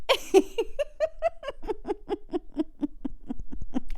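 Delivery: high, long wheezing laugh; mock laugh. Kid told to go in wetsuit, high wheezing laugh. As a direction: let out a long squeaky stuttering laugh. Retold reaction to teacher.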